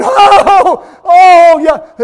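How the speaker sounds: loud, high wailing complaint; hammed-up moan of self-pity; mock griping. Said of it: "griping and complaining and saying".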